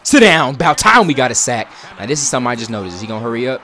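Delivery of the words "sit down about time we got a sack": loud, higher celebration; excited shout. Real, not acted. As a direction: cheer the sack. Celebrating sack.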